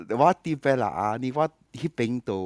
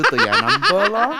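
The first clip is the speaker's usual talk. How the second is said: laughing; 10 dB louder than usual. Real, not acted. Laughs while telling the process.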